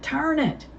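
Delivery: urgent. Acted. Urgent repeat in smoke.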